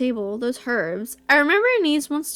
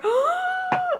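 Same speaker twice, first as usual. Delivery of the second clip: sharp gasp; 3 dB quieter than usual. Real, not acted. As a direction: suck in air in disbelief. Shocked by the question list.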